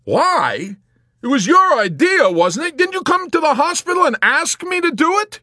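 loud, high-pitched incredulous outburst. A narrator's reading. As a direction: shout it back in angry disbelief. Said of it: "with an incredulous scowl".

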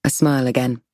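ordinary delivery, nothing marked

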